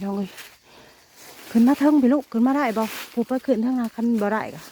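calling loudly to his daughter up the bank. Real, not acted. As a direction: call out to her so she hears you. Father calling daughter up the slope. (ตะโกนเรียกลูกสาวเสียงดัง)

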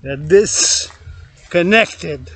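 very slow, every syllable stretched out; a slow mocking drag on "disconnected"; drawn-out repeat. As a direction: let "disconnected" crawl out slowly. Repeats line, then stretches it out.

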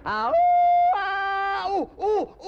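very high, loud jungle yodel; a hammed-up Tarzan call; Tarzan yell. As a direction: belt out the ape call.

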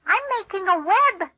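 a hint of a spider character; spider's voice. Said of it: "says the spider to the fly".

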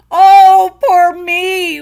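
high, loud hammed-up wail. A narrator's reading. Jamie wailing over his starving fate, high and loud. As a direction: wail in self-pity. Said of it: "wailed Jamie".

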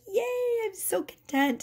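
high, small happy cheer; all happy. Acted. Content feminine cheering over her tiny life, all happy. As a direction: lightly mimic a contented woman. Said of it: "She's all happy".

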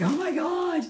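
high, loud, exasperated oh my God; shocked gasp. Retold or imitated. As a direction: blurt it out in shock. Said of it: "you were just like".

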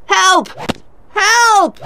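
very high cry for help; shouted. A narrator's reading. Lawyer being beaten with a whip, frightened shout. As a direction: scream for rescue. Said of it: "Wakem shouted".

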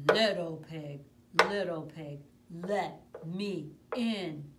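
loud, slow growling wolf at the door; big bad wolf. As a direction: boom it out like the hungry wolf. Wolf returns.

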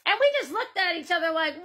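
ordinary talk, nothing marked

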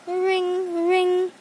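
high, sustained "ring, ring"; high-pitched spoken phone ring; phone ring imitation. A narrator's reading. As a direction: imitate a ringing telephone.

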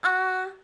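"A" high, level and loud; long, even high "A"; first tone. Shown as a demonstration. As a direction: hold "A" steady up high. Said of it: "four tones in Chinese pinyin".

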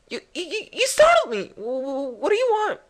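high, stammering fright; acted startled. Girl startled by a stalker at night, high startled stammer. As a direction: stammer in high-pitched mock alarm. Voicing startled girl's game dialogue.

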